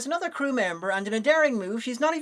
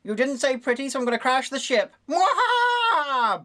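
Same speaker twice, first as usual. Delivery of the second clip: sneering panto villain; 4 semitones higher than usual. Pretend. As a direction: gloat like a cartoon villain. Plays the villain computer.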